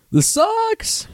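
high falsetto whine; mock meltdown. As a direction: squeal your complaint in falsetto. Mock meltdown about staph.

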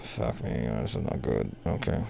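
very soft, low mutter. Real, not acted. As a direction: mumble under breath, defeated. Muttered after bad pitch.

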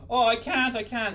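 un lamento finto di panico, più alto e più forte; mock panic. Christina panicking, voiced.

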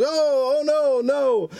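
put-on high, whimpering little-boy protest; mock tearful plea; imitated crying child. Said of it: "Finn just starts crying".

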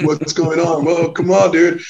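protesting shout. Retold or imitated. A guy yelling at cops tackling someone, in alarm. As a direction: cry out at the cops.

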